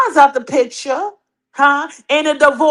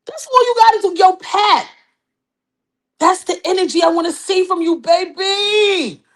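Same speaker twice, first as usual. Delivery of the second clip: excited cheer; 6 semitones higher than usual. Real, not acted. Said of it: "cheering Pat".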